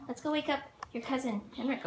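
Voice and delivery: normal talking voice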